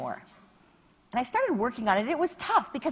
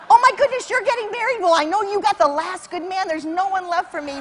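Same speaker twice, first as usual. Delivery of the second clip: jealous gushing; 7 semitones higher, 6 dB louder than usual. Retold or imitated. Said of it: "You know".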